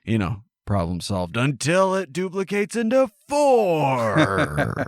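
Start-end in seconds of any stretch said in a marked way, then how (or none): 1.4-4.9 high, slow and drawn out; silly high voice. Pretend. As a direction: play it high and slow for laughs. Comic punchline voice.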